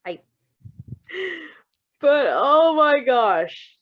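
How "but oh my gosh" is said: slow, drawn-out and much louder; stunned gasp. Real, not acted. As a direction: gasp it out in real shock. Reader stunned by plot twist.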